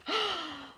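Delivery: high sharp intake of breath; startled gasp. A narrator's reading. Narrator gasping at the knock, startled. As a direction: gasp in fright.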